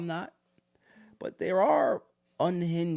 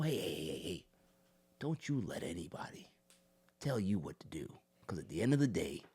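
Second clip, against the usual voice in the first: low, soft, under-the-breath counsel; hushed confiding advice; 10 semitones lower, 10 dB quieter than usual. Acted. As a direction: lean in quietly, voice sinking to a whisper at the end. Voices confiding advice in a quote.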